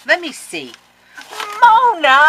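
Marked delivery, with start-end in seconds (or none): 1.6-2.3 excited cry. Real, not acted. Calls out the sender's name.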